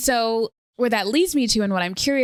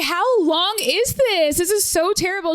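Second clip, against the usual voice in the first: mock despair; 8 semitones higher than usual. Asking her friend how long it lasts, hammed-up despair. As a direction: drag out the complaint in hammed-up misery. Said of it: "I was like".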